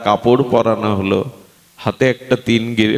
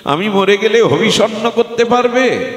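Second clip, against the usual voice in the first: high, mounting series of questions; declaimed, insistent questioning; challenging devotee; 8 semitones higher than usual. Acted. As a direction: raise the pitch with each challenge. Preacher voices deity challenging devotee.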